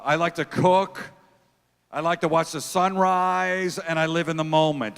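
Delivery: slow, lilting mock-romantic read; dreamy profile voice. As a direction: read it like a cheesy dating profile, drawn out. Mock dating profile read aloud.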